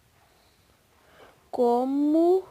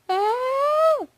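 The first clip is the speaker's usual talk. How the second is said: scream; 11 semitones higher, 6 dB louder than usual. Real, not acted. Takes damage in game.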